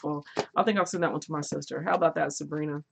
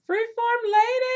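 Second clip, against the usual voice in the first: much higher, slow, drawn-out call; excited greeting; 5 dB louder, slower than usual. Real, not acted. Greeting Freeform Lady in chat, sung-out high call. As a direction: sing out the name in delight.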